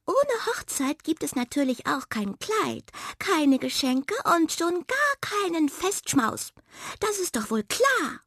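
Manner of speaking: high-pitched indignant little mouse; Waldmaus, empört. Acted. Forest mouse voiced in story. (hohe, empörte Mäusestimme)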